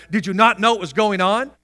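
usual speaking voice

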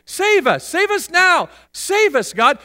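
high, loud repeated plea; urgent cries for rescue; crowd crying out. Acted. Preacher acts the people calling on God, crying out. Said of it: "people are all crying out".

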